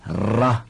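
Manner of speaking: ra slowly, with a trilled tongue-tip r; ra drawn out with a rolled r. Shown as a demonstration. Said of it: "念慢一点".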